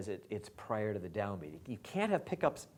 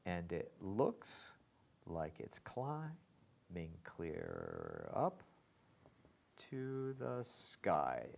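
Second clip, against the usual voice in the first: slow, softer, pauses after looks and up; anapestic scansion; 4 semitones lower, 6 dB quieter, much slower than usual. Shown as a demonstration. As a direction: scan each foot slowly. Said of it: "Let's keep going on that".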